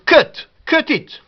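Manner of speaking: "Cut" high and punched, uh vowel. Shown as a demonstration. Teacher demonstrating U said E, punched uh. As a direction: say "Cut" short with a clear uh.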